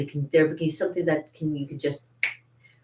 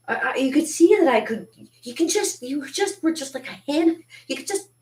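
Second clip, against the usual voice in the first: much higher, sputtering with indignation; indignant outburst; 10 semitones higher than usual. Retold or imitated. Telling the friends they could have just helped, sputtering and much higher. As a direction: sputter as you struggle to say it.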